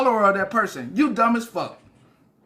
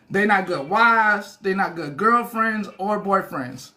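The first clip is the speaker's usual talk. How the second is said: slow emphatic; slower than usual. Real, not acted.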